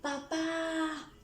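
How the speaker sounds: high, drawn-out summons; child calling a parent from afar. Shown as a demonstration. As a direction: hold the last vowel high as you call. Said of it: "tú estás llamando".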